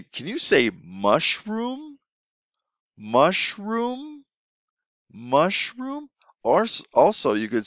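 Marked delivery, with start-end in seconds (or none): 0.0-6.1 high, slow, repeated prompt; teacher eliciting a word. Shown as a demonstration. Teacher getting a student to say mushroom, high and slow. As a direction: coax the child to repeat it.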